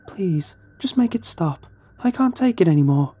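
high, desperate woman begging for it to stop; pleading woman. A narrator's reading. Unseen woman begging the trapped man for relief, pleading. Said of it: "a faint voice".

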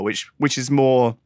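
ordinary talk

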